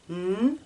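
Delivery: N held as a long humming nasal sound; teacher models the N sound alone; sustained N sound. Shown as a demonstration. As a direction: hum the N before the vowel. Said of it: "Je me prépare à bien prononcer le son N".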